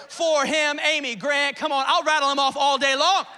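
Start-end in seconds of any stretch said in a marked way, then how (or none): none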